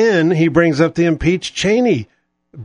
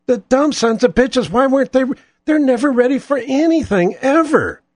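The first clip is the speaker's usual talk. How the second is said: ordinary talk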